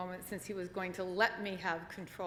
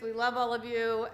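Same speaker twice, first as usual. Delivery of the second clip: normal talking voice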